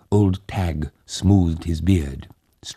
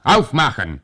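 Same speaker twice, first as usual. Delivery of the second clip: louder, higher German command; German shout; 8 semitones higher, 5 dB louder than usual. A narrator's reading. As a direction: bark it like a soldier. Soldier orders from outside.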